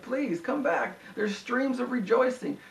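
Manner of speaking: pleading, drawn out and rising high; retold weeping appeal, only lightly acted; imitated tearful plea. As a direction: mildly imitate a preacher pleading through tears. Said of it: "he starts bawling his eyes out".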